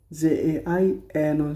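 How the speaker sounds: dictating letters slowly; slow spelling. Shown as a demonstration. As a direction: spell জায়নো for the class. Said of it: "spelling out".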